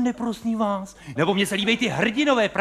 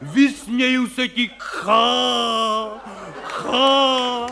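mock actor bellowing defiance at death, louder and higher; hammed-up heroic roar; 3 semitones higher, 3 dB louder, much slower than usual. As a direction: ham it up like a pompous stage hero shouting at the wind. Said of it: "křičejí tam, prostě řvou". (přehrávaný hrdinský řev)

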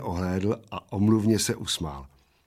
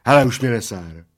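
mild annoyed outburst; understated exasperated warning; irritated exclamation; 7 dB louder than usual. A narrator's reading. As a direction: call it out, fed up. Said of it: "zvolal plešatec".

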